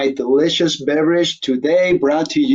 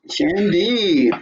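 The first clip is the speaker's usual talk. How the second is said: savoring the word; 5 semitones higher than usual. Real, not acted. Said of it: "It just rolls off the tongue".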